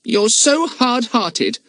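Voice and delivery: slow, high mock groan. Jack complaining to Aunt Allie, groaning. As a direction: moan it in protest. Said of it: "groaned Jack".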